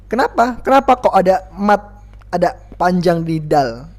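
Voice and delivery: ordinary delivery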